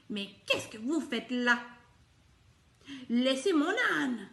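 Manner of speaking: mildly furious master, voiced by a storyteller; ton furieux. A narrator's reading. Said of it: "crie d'un ton furieux". (colère jouée, modérée)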